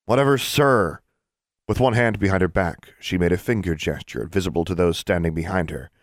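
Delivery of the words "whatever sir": insolent. Acted. Defiant schoolgirl.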